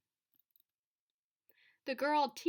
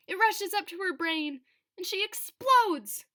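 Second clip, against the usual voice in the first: louder and higher, peaking on "explodes"; building to an explosion; 5 semitones higher, 7 dB louder than usual. A narrator's reading. Narrator telling children how the girl explodes, building loud. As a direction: let the excitement surge into a burst. Story builds to the girl's outburst.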